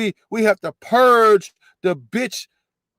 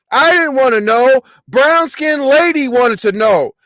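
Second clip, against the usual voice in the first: loud mocking imitation; 6 semitones higher, 7 dB louder than usual. Host loudly mimics the woman shifting blame, mocking. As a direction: mimic her excuse at a higher, louder pitch. Mimicking her excuse, shouting.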